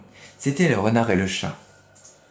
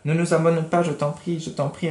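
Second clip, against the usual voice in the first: higher begging cat voice; pleading cat; 6 semitones higher than usual. Acted. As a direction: plead in the cat's high voice. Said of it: "répéta le chat". (le chat implore, voix plus aiguë)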